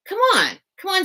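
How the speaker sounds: high, loud wheedling coax; teasing cellmate. Retold or imitated. Cellmate pressing the scared new girl, high and loud. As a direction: egg her on like a pushy cellmate.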